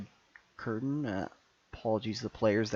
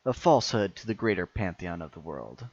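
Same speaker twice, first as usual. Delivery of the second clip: louder, grand villain speech; booming echoing villain monologue; 3 dB louder than usual. Acted.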